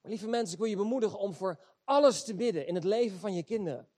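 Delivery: ordinary talk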